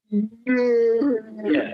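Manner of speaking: loud, high yelling Ogre voice; Ogre bellow. Retold or imitated. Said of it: "my ogre impression".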